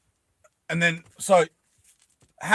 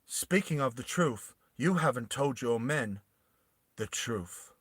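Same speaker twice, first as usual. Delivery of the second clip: softer, pointed reveal; quiet accusation; 3 semitones lower, 8 dB quieter than usual. Acted. Queen Bella exposing Hammy to his men, softer. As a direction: lower your voice and expose him. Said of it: "she looks over at Hammy and she goes".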